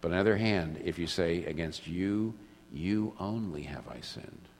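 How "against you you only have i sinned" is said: softer, slow, hushed prayer; tender confession. Pretend. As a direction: confess quietly to a God you have hurt.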